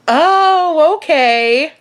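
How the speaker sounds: high, drawn-out "oh", hammed up; forced acceptance. Guest answering a killing remark, high awkward acceptance. Stunned reply to threat.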